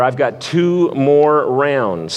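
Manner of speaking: ordinary delivery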